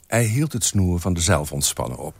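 ordinary delivery

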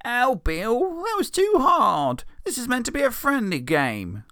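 put-on high, slow, whiny kid protest; young Morty whining to Bill; crying Morty. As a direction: wail it like a hurt boy. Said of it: "cries Morty".

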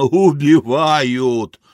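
slow, drawn-out languid cry; languid complaint. Acted. Arthur being throttled, languid drawn-out cry. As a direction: let it out long and loud, languidly. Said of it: "Артур томно".

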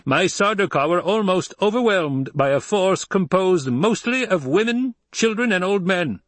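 high, loud, imperious harangue; indignant Emperor. Acted.

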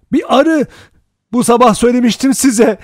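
high, loud, agitated shouting; loud, high outburst; crying out. A narrator's reading. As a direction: shout it shrilly at the whole room. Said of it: "diye haykırdı Vera".

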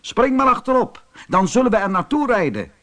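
high, projected, hurrying invitation; storyteller voicing a rider calling down; rider answering. A narrator's reading. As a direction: call the invitation out briskly. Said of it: "antwoordde de ruiter".

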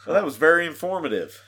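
dry sarcastic remark, quick and loud; mock praise for a useless clip. Real, not acted. After a pointless clip.

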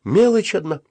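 high, plaintive admission; near-tearful cry. A narrator's reading. Boy confesses he has only change, near tears. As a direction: wail it lightly, pitched well up. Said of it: "чуть не плача закричал я".

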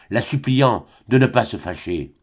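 ordinary delivery, nothing marked

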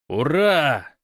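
loud, high hurrah; shouted cheer. A narrator's reading. Men answer that they are going, shouting hurrah. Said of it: "хором заорали мужчины".

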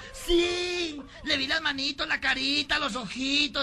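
high-pitched campy wail; melodramatic lament. Acted. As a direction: plead it in a high theatrical voice. (lamento agudo y afectado)

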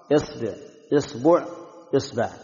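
إصبع said as isba', then isbu', then isbi'; hamza with kasra, three ba vowels. Shown as a demonstration. Teacher listing the kasra forms of the word for finger. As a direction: drill إصبع starting on i, changing the ba each time. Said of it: "كسرها".

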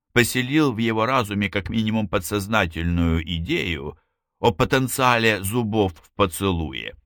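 plain reading voice

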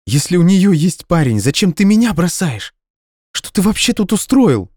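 high, loud exasperated voice; indignant demand. Acted. Indignant girlfriend demanding why he leaves, high and loud. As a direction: demand an explanation.

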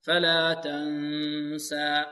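slow chanted تنسى with a nasal hum on the ن; nasal ghunna. Shown as a demonstration. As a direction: hold the ن of تنسى long through the nose. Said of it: "الصوت الذي يخرج من الخيشوم أكثر". (غنة من الخيشوم في نون تنسى)

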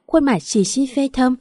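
ordinary delivery, nothing marked

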